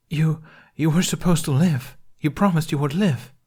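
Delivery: loud, high, pleading anguish. Acted. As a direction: cry it out, heartbroken. Anguished accusation.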